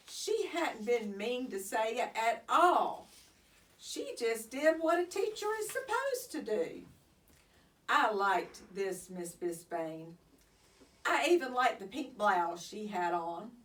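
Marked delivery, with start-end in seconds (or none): none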